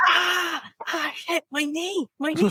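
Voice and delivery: high-pitched cry of pain, hammed up; mock pained yelp. Man smacks his knee on coffee table, mock pained wail. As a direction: imitate someone yelping after a sudden knock.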